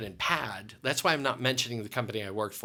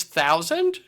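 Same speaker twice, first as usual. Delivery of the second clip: loud rising Thousand; surprised question; 5 semitones higher, 10 dB louder than usual. Retold or imitated. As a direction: check the number, startled. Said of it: "she goes".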